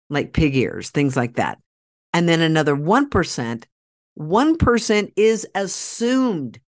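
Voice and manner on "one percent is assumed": "assumed" drawn out and leaned on; stress on assumed. Shown as a demonstration. Host underlining a word for viewers, stressing "assumed". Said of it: "I'm gonna underline that word".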